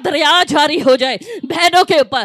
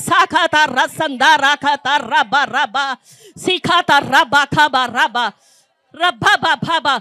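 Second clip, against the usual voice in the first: unhurried babbling with a laugh; slow tongues; 3 semitones lower, slower than usual. Real, not acted. Preacher praying in tongues while the congregation prays, slow and laughing. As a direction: let the tongues roll out slowly.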